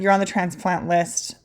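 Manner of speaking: ordinary talk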